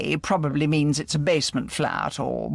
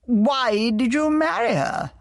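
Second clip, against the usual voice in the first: curious; 8 semitones higher, 3 dB louder than usual. A narrator's reading. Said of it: "asked James curiously".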